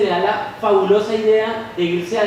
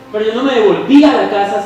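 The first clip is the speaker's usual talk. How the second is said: usual speaking voice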